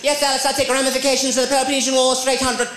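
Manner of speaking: high, brainy quiz-show contestant; smug quiz contestant voice; Jeopardy contestant. Retold or imitated. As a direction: play the know-it-all contestant. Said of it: "Jeopardy, they're like".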